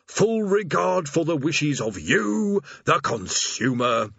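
slow, high, stoic recital; stoic demon. Acted. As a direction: plough through the script stoically. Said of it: "the demon continued stoically".

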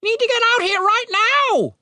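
very high, urgent warning; alarmed raven. A narrator's reading. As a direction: rush out a warning in a high bird voice. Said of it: "with alarm in its voice".

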